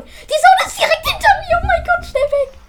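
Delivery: high, laughing panic as the zone closes in; panicked laughing. Real, not acted. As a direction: squeal it out through laughter. Zone closing in behind her.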